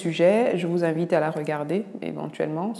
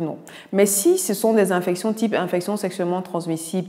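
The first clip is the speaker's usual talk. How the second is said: ordinary talk, nothing marked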